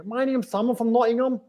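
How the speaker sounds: high, put-on Scouse introduction; Liverpool accent. Nottingham speaker pretending all day, put-on Liverpool accent. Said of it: "putting on the Liverpool accent".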